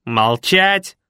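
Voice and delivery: a different, nasty voice barking from nowhere; an unseen creature snaps at him. A narrator's reading. Hidden voice under the bed snaps at the man. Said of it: "ответил противный голос".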